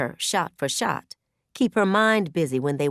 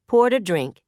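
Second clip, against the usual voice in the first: ordinary delivery, nothing marked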